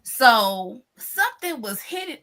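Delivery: ordinary talk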